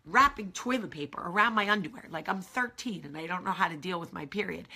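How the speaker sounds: normal talking voice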